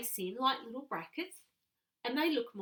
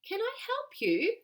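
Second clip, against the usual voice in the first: much higher and louder, bright helpful question; eager offer of help; 12 semitones higher, 7 dB louder than usual. Acted. Reading Miss Uldricus's question.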